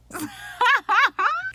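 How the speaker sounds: high squealing laugh. Real, not acted. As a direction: burst out at your own joke. Own joke amuses her.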